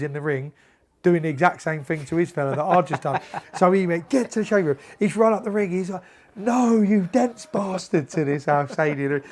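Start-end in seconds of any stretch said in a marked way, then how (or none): 4.1-4.8 imitated yelled order. Coach yelling order at boxer, retold. 6.3-8.1 high, drawn-out mock yell at a boxer; imitated shouting. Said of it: "like, shouting at him".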